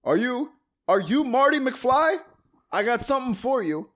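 hammed-up bearer of important news; movie messenger. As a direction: call out to a stranger with great ceremony. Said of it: "reminds me of Back to the Future Two".

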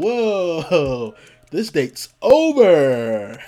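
loud, drawn-out comic alarm; amused shock. Retold or imitated. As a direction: stretch it out, laughing. Retold shock, laughing.

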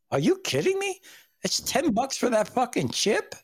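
high incredulous question; disbelief. Real, not acted. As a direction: say it like you can't believe it. Outraged at chip price.

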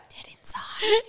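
breathy whispered hollow voice; dead inside. Pretend. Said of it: "It's like".

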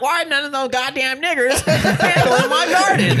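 high, squeaky caricature of a furious lady; mock outrage in a high cartoon voice; mocking an angry old lady. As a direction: sneer it out in a shrill put-on voice, laughing. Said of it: "She was like".

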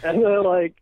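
normal talking voice

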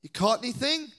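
high, loud friendly hail; calling out. Retold or imitated. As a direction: shout a cheerful greeting to the boat. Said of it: "he says".

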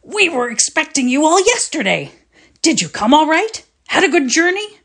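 high, flustered greeting; a father fussing in a rush of alarm; alarmed cry. Acted. Father greeting his son in the hall, alarmed cry. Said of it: "cried out in alarm".

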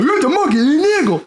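high, squeaky mock scientist; goofy scientist voice. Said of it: "was like".

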